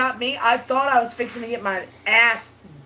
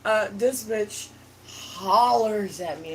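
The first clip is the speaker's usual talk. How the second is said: ordinary talk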